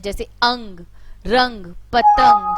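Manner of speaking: अंग, रंग, पतंग with the ं ringing through the nose; nasal anusvar demo. Shown as a demonstration. Teacher showing nasal sounds, slow and loud. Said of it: "इसका उच्चारण केवल नाक से होता है".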